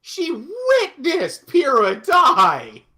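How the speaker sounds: loud, high, drawn-out exclamation; impassioned outburst. Real, not acted. Fan ranting to co-hosts about Ruby, impassioned outburst. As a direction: shout it slowly in outrage. Heated rant about Ruby's trauma.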